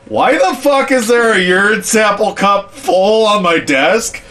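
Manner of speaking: high, loud outraged boss voice; mock angry boss. Boss back from a meeting finds the cup, outraged.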